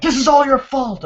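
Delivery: high, loud retold accusation; mock angry blame. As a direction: throw the blame out in a high-pitched yell. Retold blaming of the girlfriend.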